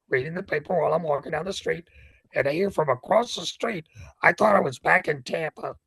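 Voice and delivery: ordinary talk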